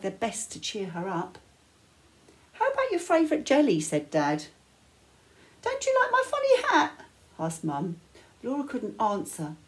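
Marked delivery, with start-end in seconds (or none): 2.5-3.8 higher, louder, fast cheery dad; a bouncy dad coaxing a smile. Acted. Dad trying to cheer her. 5.6-7.1 much higher, louder playful mum voice; a goofy mum clowning to cheer her; silly Mum. Acted.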